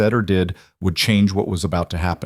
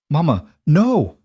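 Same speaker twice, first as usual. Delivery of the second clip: put-on frightened child; 7 semitones higher, 4 dB louder than usual. A narrator's reading. Terrified child clinging to mother.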